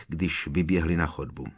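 ordinary delivery

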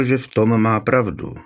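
usual speaking voice

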